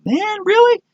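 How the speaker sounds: very high mock whine of disappointment; exaggerated high-pitched letdown; bummed out. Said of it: "I was bummed out".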